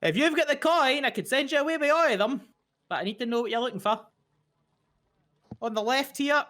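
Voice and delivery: high, quick Irish shopkeeper; chirpy Irish sales pitch; Irish twang. Acted. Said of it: "she goes".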